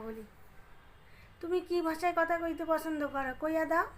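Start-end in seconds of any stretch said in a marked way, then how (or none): 1.4-3.9 Bangal dialect. Pretend. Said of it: "আমি একটু বাঙাল ভাষায় কথা কইতাছি".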